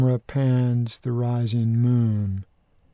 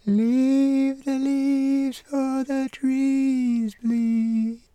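very high, slow falsetto chant; 13 semitones higher, much slower than usual. Acted. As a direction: sing it in a thin high falsetto. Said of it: "That high-pitched falsetto belongs to the pawpaw tree".

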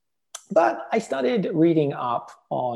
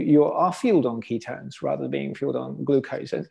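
usual speaking voice

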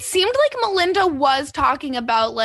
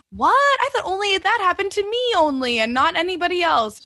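mock surprise; 7 semitones higher than usual. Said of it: "the other women are all like".

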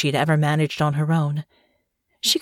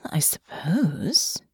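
thoughtful; 3 dB quieter, much slower than usual. Acted. Thoughtful concession.

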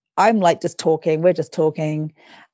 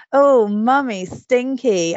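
put-on higher singsong child's teasing; imitated small child; 6 semitones higher, much slower than usual. Mum mimics her three-year-old teasing her, childlike. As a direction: tease like a little kid, pitched up.

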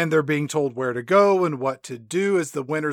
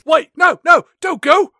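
high, loud hammed-up begging; mock panicked plea; 9 semitones higher, 6 dB louder than usual. [name] to the leaving Spice Girl, high mock panic.

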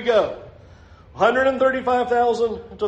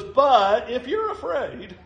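higher, slow, drawn-out offer; coaxing concession; 4 semitones higher, slower than usual. Retold or imitated. Preacher voicing God indulging fearful Gideon, coaxing. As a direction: stretch it out like a playful caveat. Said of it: "and he goes".

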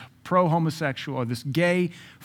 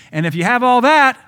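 much higher, far louder climax; emphatic shout; 10 semitones higher, 12 dB louder than usual. Real, not acted. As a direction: cap the mounting list with a shout. Preacher peaks after a mounting list.

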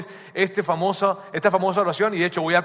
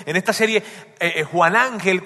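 normal talking voice

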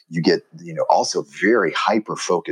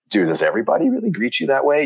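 higher, lightly voiced baffled question; a mild mock of disbelief; puzzled foreigner; 8 semitones higher, faster than usual. Visitor questioning American greetings, lightly mock baffled. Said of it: "it's also like".

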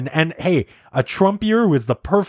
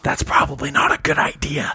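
library whisper. Shown as a demonstration. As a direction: keep it hushed. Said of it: "whisper for the whole episode".

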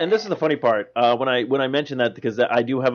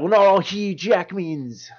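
higher, slow Aussie protest; Australian accent; 6 semitones higher, slower than usual. Acted. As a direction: stretch it out in broad Australian. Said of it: "a long rant from an Australian".

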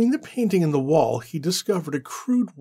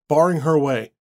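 plain reading voice

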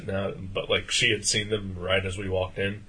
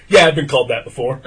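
loud, higher heckler's comeback; imitated shout back; 4 semitones higher, 14 dB louder than usual. Heckler calling after the man leaving, loud comeback. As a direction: imitate the stranger hollering after someone. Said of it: "I hear him say".

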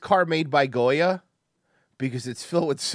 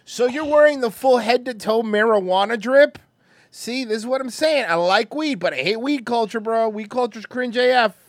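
high, raised-voice mocking complaint; exasperated rant; 8 semitones higher, 6 dB louder than usual. Real, not acted. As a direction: rant loudly in disbelief. Ranting at person in the video.

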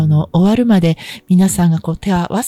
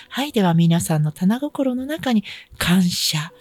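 guided meditation; 2 semitones higher, 5 dB quieter than usual. Acted. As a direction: guide listeners gently into prayer. Leads a short healing for listeners.

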